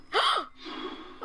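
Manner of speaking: sharp high gasp of fright; frightened intake of breath; startled gasp. Real, not acted. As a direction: gasp at the jump scare. Jump scare in horror game.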